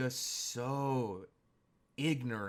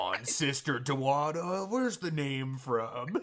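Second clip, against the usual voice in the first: mock pushy coaxing; 2 semitones higher, 7 dB louder than usual. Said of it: "And he's like".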